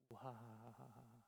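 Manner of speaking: slow, soft mock cow laugh; hammed-up cow laughing. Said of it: "소가 웃을 때 어떻게 웃으시는지". (소 웃음소리)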